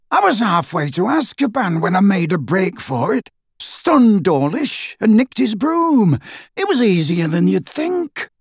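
rough wizard voice. A narrator's reading. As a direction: voice him as a cocky escaped wizard. Dirk, higher character voice.

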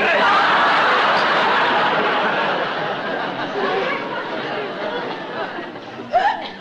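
long breathy laughing fit. Acted. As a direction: laugh it out fully. Long laugh at broken watch.

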